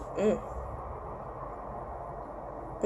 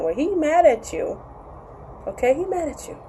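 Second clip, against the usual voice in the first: "mad" lifted high and stretched; stress on mad; 7 semitones higher than usual. Real, not acted. Vlogger stressing to viewers that he is mad. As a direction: lean on "mad" with a raised pitch. Emphasising her point.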